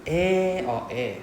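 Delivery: slow Eh, then a second Eh; two kinds of eh. Shown as a demonstration. Teacher contrasts two ways to answer slowly. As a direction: contrast a drawn Eh with a short Eh. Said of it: "two ways to reply this".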